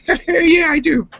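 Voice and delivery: a cheerful squeaky impression of the buddy; goofy high imitation. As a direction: play the friend laughing along. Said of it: "He'd be like".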